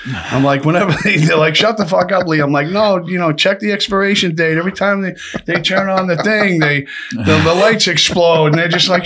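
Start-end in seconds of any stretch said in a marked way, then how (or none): 1.6-2.4 high mock yell at the inmate; retold guard shouting shut up. Guards yelling at prisoner in story. 2.7-8.5 high, fake-innocent protest; mock innocent. Playing innocent to the guards.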